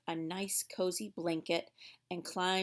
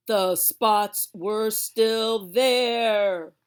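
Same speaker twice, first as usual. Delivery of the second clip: louder, very slow letdown; dramatic reveal; 9 dB louder, much slower than usual. Acted. Girl finding the bandaged spots remain, slow and loud. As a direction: drag it out with big disappointment.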